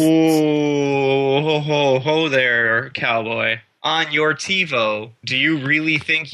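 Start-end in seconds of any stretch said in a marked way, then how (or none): 0.0-3.6 teasing whoa. Real, not acted. Reining in partner's argument.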